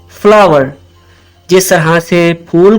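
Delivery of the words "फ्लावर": फ्लावर as fla-wer, falling; correct flower pronunciation. Shown as a demonstration. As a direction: model फ्लावर with open 'aa' and 'wer'. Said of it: "इसकी सही pronunciation है". (फ्लावर, 'आ-वर' वाला सही उच्चारण)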